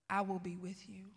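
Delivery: low, soft divine reassurance; God's quiet voice. Retold or imitated. God reassuring the preacher's father, quiet and low. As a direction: say it softly and low, as God speaking. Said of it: "hearing God very clearly say this".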